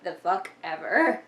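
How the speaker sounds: slow, low, drawn-out eye-roll; dismissive. Retold or imitated. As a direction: brush it all off like it bores you. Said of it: "And I'm just like".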